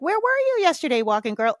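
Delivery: high, sweet neighbour voice; light, sing-song greeting; high friendly neighbour. Retold or imitated.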